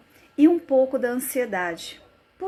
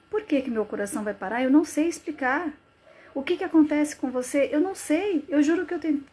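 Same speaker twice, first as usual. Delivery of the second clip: higher, agitated self-questioning; acted distress; 6 semitones higher, faster than usual. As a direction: plead that you don't know, pitch climbing. Acted panic, questioning herself. (aflição encenada, voz mais aguda)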